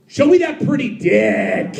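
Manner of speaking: slow, high, drawn-out holler; mock bellow, stretching the last word; imitated yell. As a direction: mimic a drunk fan yelling it slowly. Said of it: "Why are people yelling dick".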